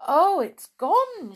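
high, slow, loud dismay; dismayed alarm. Acted. Molly seeing the wishing chair gone, dismayed. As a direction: draw it out in shocked dismay. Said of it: "Molly went pale".

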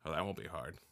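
low, soft muttered aside; quiet grumble meant for nobody else; muttering to himself. Pretend. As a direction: mutter it privately, under your breath. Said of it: "mutters to himself".

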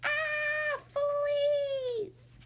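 high, drawn-out scream of alarm; long, high shriek; panicked yell. A narrator's reading.

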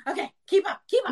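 high, hurried mock panic to keep pace; mock frantic self-talk. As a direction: play at scrambling to keep up. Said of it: "I'm like".

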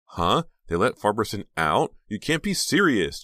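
high, loud disbelief; incredulous outburst climbing in pitch; shrieking. A narrator's reading. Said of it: "Jade shrieked".

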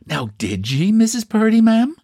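high, gushing Irish gossip; admiring Irish woman. Acted. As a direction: marvel at her like an admiring neighbour. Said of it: "with intense admiration".

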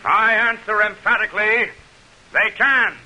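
measured, declaiming public oratory; senator's debate speech to the crowd in measured oratory; formal speech. Acted. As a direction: address the crowd from the debate platform. Douglas answers Lincoln's debate question.

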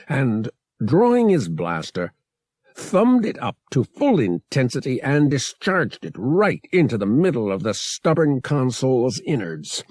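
plain reading voice

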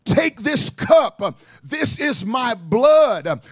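very slow, weighty words; slow solemn Jesus. Retold or imitated. Preacher voicing Jesus at the last supper, slow and solemn. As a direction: lay each word down slowly like a sacrament.

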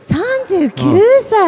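high, loud, drawn-out astonishment; amazed echo. Real, not acted. Co-host hearing her partner's age, real surprise. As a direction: repeat it slowly in loud amazement.

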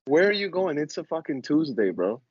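ordinary delivery

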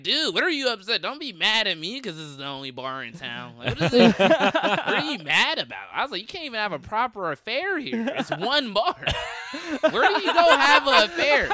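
high, loud disbelief at the biker; imitating himself shouting from the stage, half laughing; mock incredulous heckle. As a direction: throw it at him, laughing in disbelief.

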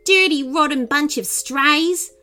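high, scornful snap; snapping insult. A narrator's reading. Woman insults the group of strays, snapping. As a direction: hurl it as an insult. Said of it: "she snapped".

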